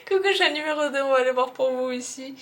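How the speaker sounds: excited. Real, not acted. Cheerful greeting to viewers.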